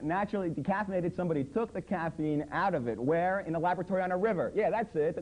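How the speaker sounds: ordinary delivery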